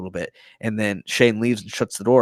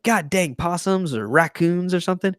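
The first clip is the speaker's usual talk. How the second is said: higher-pitched mock annoyed griping; retold grumbling; 7 semitones higher than usual. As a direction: imitate a man cursing at pests. Acted grumbling man on ladder.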